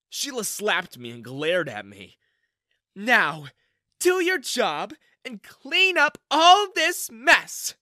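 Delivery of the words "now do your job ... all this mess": high, loud, slow barked order; angry command. Acted. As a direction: bark it like a furious boss. Manager orders after slapping him.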